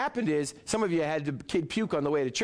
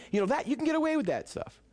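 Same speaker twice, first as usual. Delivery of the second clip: ordinary talk, nothing marked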